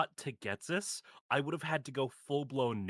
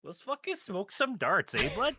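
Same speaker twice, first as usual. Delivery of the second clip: exaggerated Canadian accent; 6 semitones higher, 3 dB louder than usual. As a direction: play a loud Canadian hoser in a thick Canadian accent. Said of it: "do the Canadian accent".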